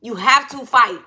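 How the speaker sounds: usual speaking voice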